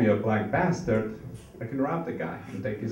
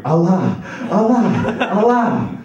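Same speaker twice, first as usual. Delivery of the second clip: loud, high ecstatic cries; hammed-up climax cries, rising; father crying out; 10 semitones higher, 10 dB louder, slower than usual. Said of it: "so he was really going".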